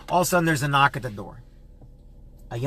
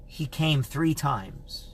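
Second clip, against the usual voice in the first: each word laid down very slowly; slow solemn reveal; 3 dB quieter, much slower than usual. Retold or imitated. Stranger tells the host he came three times, very slow and solemn.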